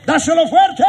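high, loud shouted rallying cry; full shout. Real, not acted. Preacher shouting at the congregation to pray hard. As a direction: shout it out to the church.

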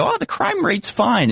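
high, slow, airy brush-off; mock naive dismissal. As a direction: play the clueless optimist waving it away. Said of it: "you can go".

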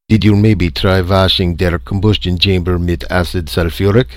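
put-on loud, thick German accent; German-accented engineer. A narrator's reading. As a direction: play a German engineer offering technical advice. German engineer character questions Jarvis.

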